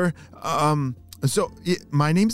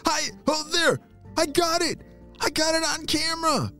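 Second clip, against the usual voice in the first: put-on high, thrilled boy's voice; so excited. A narrator's reading. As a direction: cry out in high-pitched triumph. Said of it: "Derek was so excited".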